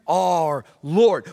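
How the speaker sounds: slow, hushed reverent address; reverent prayer. Shown as a demonstration. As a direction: pray it slowly and humbly. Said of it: "we come before God and go".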